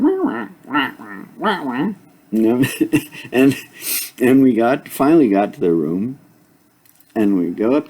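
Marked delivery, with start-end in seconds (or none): 0.0-1.9 high nasal wah wah quacks; duck quacking. Retold or imitated. Duck walking beside him in rain, high quacking wahs. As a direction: quack like the duck waddling alongside. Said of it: "the duck walking next to me ... Going".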